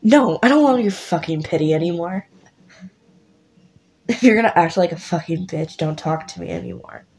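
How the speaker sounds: lightly voiced, shocked then bitter friend; a hint of hurt turning to spite; stung friend [name]. [name] just got backhanded by his friend, bitter. [name] after being slapped.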